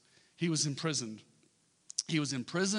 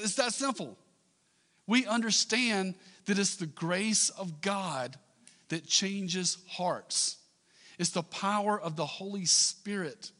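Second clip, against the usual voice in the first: raised, higher-pitched pulpit delivery; impassioned preaching; 5 semitones higher, 4 dB louder than usual. Real, not acted. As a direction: drive each point home, stretching the key words. Preaches with rising force on grace.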